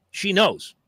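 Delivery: normal talking voice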